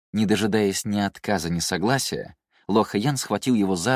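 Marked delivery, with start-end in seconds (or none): none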